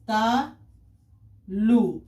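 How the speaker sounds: very slow, stretched syllables with a gap. Shown as a demonstration. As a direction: sound it out slowly for pupils. Teacher sounding out word for class. (एक-एक अक्षर खींचकर)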